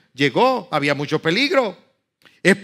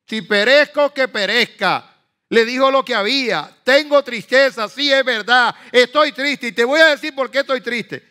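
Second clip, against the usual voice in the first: raised high, loud declaration of sorrow; loud defiant tone; 7 semitones higher, 5 dB louder than usual. Retold or imitated. Nehemiah answering the king, in a loud bold tone. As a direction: belt it out boldly to the king. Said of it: "No se lo dijo en ese tono".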